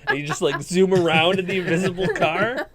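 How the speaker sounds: normal talking voice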